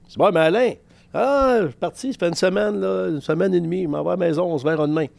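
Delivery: mock coach. Coach Alain brushing off reporters.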